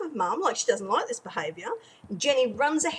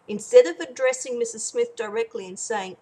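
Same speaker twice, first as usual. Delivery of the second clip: ordinary talk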